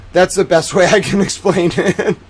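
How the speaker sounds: real laughter breaking into speech; laughing. Real, not acted. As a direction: crack up while wrapping up. Cracks up after own impressions.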